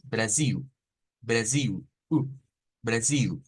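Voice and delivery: Brasil ending in a brief U instead of L; short-U ending. Shown as a demonstration. Teacher shows the other pronunciation, short U. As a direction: finish Brasil on a quick short U. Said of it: "si usamos la otra pronunciación".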